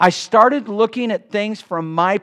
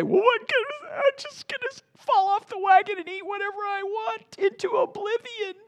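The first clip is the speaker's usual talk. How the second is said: much higher put-on whiny voice; mock self-pity; 6 dB quieter than usual. A frustrated dieter talking himself off the wagon, high mocking whine. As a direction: whine it like a petulant dieter giving up. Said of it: "And I could say".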